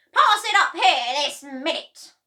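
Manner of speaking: high, very loud snarl; hag shouting. A narrator's reading. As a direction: shout the boy down.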